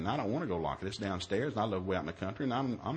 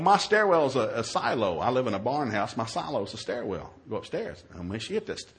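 ordinary talk

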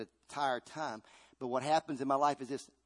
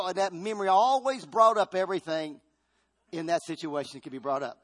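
ordinary talk

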